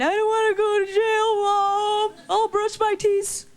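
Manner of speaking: high, whiny child pleading through fake tears; hammed-up little girl begging not to go to jail; mock crying kid. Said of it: "I'd be all crying".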